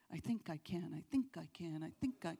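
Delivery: sing-song the little engine, mockingly; mocking self-talk. Preacher mocking faith in faith, soft sing-song chant. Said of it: "don't have faith in faith".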